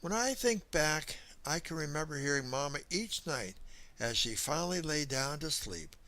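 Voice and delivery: usual speaking voice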